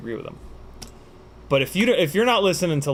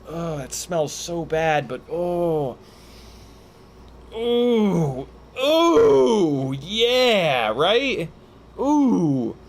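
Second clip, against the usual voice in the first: high drawn-out "ooh" of pained pleasure; stank-face groove moan; 3 semitones higher, much slower than usual. Shown as a demonstration. Streamer showing fans the face funky music causes, stank-face moaning. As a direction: groan as if it smells awful but you love it. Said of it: "that stank face, like".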